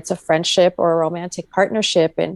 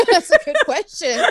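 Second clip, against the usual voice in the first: laughing; 10 semitones higher than usual. Real, not acted. Laughing at a question.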